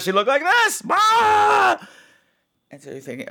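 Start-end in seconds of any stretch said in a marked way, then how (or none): 0.0-1.8 monster face shout. Shown as a demonstration. Showing the daughter's face as she pushed the girl, a high monster shout. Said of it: "with a face that looked like this".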